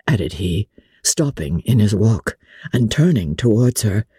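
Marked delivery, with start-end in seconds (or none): none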